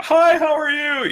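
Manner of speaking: mock greeting shouted across the street, loud and high; yelled greeting. Shown as a demonstration. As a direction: call the friendly hello across the distance. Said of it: "I don't like the idea of yelling".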